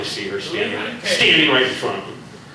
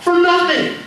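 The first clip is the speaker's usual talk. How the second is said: high alarmed Reika; 12 semitones higher than usual. Acted. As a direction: plead that he's losing his mind. Reika pleads desperate.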